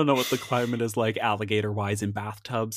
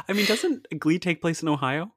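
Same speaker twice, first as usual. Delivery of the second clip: usual speaking voice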